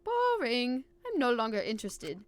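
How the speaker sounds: put-on bored villain with a German accent. Villain losing interest in his rivals, German accent. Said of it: "yell all that shit out with a German accent".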